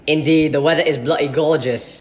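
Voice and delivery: low-pitched mock British accent; copied British accent. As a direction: put on a British accent to match theirs. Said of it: "talk in that exact same accent".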